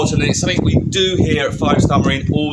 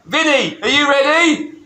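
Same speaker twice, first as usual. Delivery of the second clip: high-pitched call to a colleague; 10 semitones higher than usual. Real, not acted. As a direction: call over to check they are ready. Calls over to Vinny.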